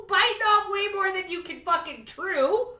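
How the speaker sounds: gleeful high emphatic shout; high excited repeat. Shown as a demonstration. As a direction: shout it again with a cackle of delight.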